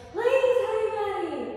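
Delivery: high, loud pleading; pleading lover. Pretend. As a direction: beg for your honey buddy. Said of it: "And you're like".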